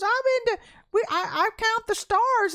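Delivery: high, flustered barista imitation; upset young lady. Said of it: "all upset".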